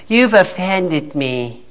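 soft, slow, drawn-out mock grievance; mock offended. Preacher mimics a brainwashed complainer, mock offended. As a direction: imitate a thin-skinned complainer, softly and slowly.

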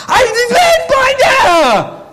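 long, high screamed gibberish; garbled joyful outburst. Retold or imitated. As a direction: scream as if the words won't form. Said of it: "comes out of your body like".

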